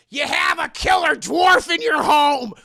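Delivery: loud, high caller shout; imitated urgent warning.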